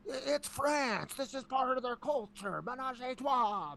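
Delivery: high-pitched mock yell; drawn-out, shrill-pitched shout at mom. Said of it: "just yelling at her".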